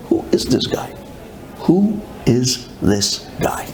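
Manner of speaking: hushed, slowing disbelief; bewildered whisper. Retold or imitated. Wife wonders who her husband is, whispering. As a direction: whisper it, then space out each word.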